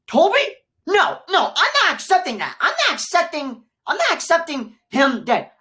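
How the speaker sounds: loud, high-pitched protest; outraged refusal. Real, not acted. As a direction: refuse it at the top of your voice. Refuses to accept Toby's death.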